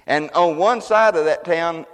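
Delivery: normal talking voice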